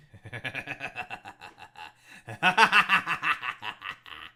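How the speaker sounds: long hearty laugh; loud laugh. Real, not acted. Laughs at viral video mention.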